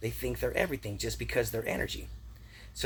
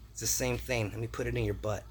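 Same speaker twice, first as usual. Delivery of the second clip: ordinary talk, nothing marked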